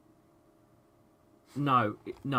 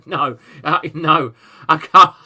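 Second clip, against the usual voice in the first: flustered refusal; 3 semitones higher, 13 dB louder, slower than usual. Real, not acted. Refusing the mistaken £200 donation.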